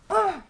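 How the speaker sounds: a sharp, loud, high disgusted grunt; angry ugh. Retold or imitated. Actress hurling milk at her co-star, angry grunt. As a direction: throw out a furious ugh. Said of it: "like that with the milk".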